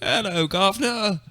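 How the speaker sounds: high, loud, slow British "Hello, governor"; over-the-top English accent; mock British accent. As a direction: ham up a cheery British greeting. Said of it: "impersonation of a British person".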